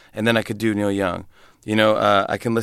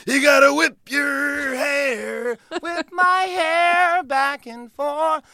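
high, slow, stretched-out shouted chant; shouted high rock-star imitation, each word stretched; Bruce jumping in with energy; much slower than usual. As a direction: belt it out high and drawn out like a rocker crashing the song. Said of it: "he's gotta jump in with that energy".

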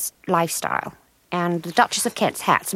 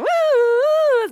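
high, loud ambulance siren imitation; mock siren hooting; siren wail; 3 dB louder than usual. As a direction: wail like an ambulance going past. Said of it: "Another one's died".

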